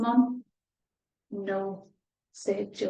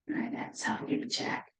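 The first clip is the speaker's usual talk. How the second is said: soft unvoiced whisper; 9 dB quieter than usual. Real, not acted. Reader whispering while checking the picture with children. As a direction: whisper as you peek at the page.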